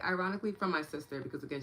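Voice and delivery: ordinary talk, nothing marked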